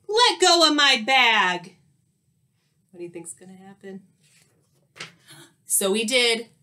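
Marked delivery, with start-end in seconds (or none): 0.1-1.8 loud, high, outraged order; indignant storybook shout; yelled demand. A narrator's reading. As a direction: yell at someone meddling. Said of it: "yelled Skunk".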